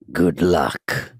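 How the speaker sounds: low, drawn-out villain quote; good luck voice. Retold or imitated. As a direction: imitate a movie villain, low and deliberate. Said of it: "That's the good luck voice".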